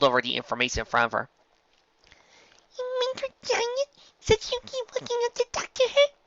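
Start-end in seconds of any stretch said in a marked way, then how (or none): 2.8-6.1 high little filly voice; small child pony, very high; high squeaky young filly. A narrator's reading. Narrator voices Dinky.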